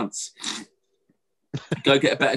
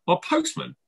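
much higher, incredulous protest; indignant retort; 8 semitones higher than usual. Retold or imitated. Arguing with the traffic warden in high disbelief. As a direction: squeak out the obviousness.

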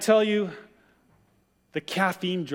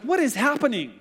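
shocked question; 7 semitones higher than usual. Retold or imitated. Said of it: "He's like".

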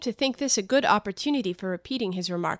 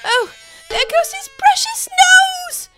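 much higher, louder frantic cry; terrified shriek; 10 dB louder, slower than usual. Acted. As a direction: shriek in panic. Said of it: "in an agony of terror".

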